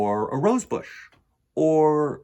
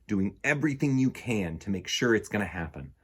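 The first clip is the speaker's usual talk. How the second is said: plain reading voice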